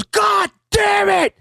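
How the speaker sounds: very high, drawn-out yell of defeat; the loser bellows in frustration at losing; frustrated shout. Retold or imitated. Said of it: "he goes".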